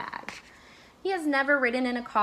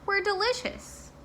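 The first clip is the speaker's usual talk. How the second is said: proud and chirpy; 10 semitones higher than usual. A narrator's reading. Donuts proudly call back.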